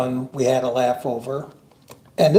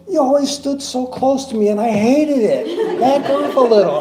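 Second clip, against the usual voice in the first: coworker; 9 semitones higher, 3 dB louder than usual. Retold or imitated. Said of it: "And she goes".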